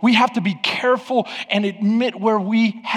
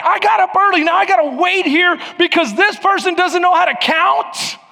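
raised, higher mock-indignant complaint; indignant rant; 8 semitones higher than usual. Pastor at Disney parking ranting at attendant, hammed-up indignant.

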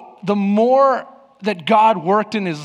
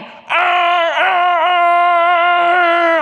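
loud, higher hammed-up honking growls; New York style yell; 8 semitones higher, 3 dB louder, much slower than usual. Father in his minivan yelling at the lot, mock rage growls. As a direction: growl at the attendant like a furious New York driver. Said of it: "I just went New York style on 'em".

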